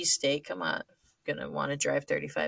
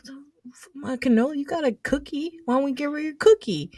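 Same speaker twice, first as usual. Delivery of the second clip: whiny; 8 semitones higher than usual. Retold or imitated. Whining to keep her cannoli.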